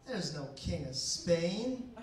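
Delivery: slow, drawn-out retort rising to a question; lady's retort. Retold or imitated. Lady in line doubting the comedian's excuse, slow puzzled imitation. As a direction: imitate a puzzled woman, stretching Spain upward. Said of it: "her response was".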